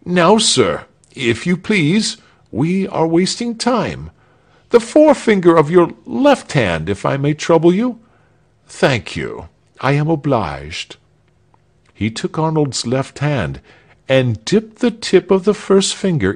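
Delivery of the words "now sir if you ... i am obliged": put-on high, fussily polite old official; courteous executioner. A narrator's reading. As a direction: usher the victim along with brisk politeness.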